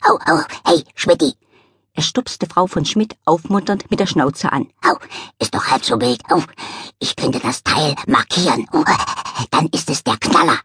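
a scruffy dog joking to cheer up his friend; dog barking "Au" while consoling the cat; cheerful little dog voice. Acted.

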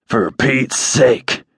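slow, short-of-breath wheeze; wheezing. Acted. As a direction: wheeze it out, gasping for air. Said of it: "Don wheezed".

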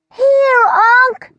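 very high, squeaky little voice; a tiny creature piping from afar; small squeak. Acted. Elf answers his uncle with a small squeak. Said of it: "The small squeak".

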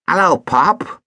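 smug stepson greeting lazily; lightly voiced cheeky boy; Ogden voice. A narrator's reading. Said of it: "Ogden Ford was sprawling".

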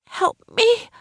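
high, strained plea for help; desperate plea. A narrator's reading. Injured passenger pleading with staring driver, desperate.

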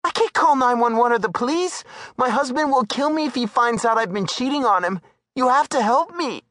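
high woman's voice, desperate; pleading Darla. Acted. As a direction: beg him not to call police.

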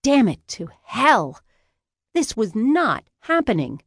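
slow, high, emphatic disbelief; exasperated outburst. A narrator's reading. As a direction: curse your luck in rising panic. Narrator's outraged disbelief at arrest.

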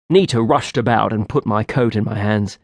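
ordinary delivery

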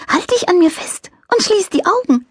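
high, light angel voice; little angel voice. A narrator's reading. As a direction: play a cheerful little angel. Angel character voice.

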